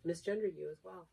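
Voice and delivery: usual speaking voice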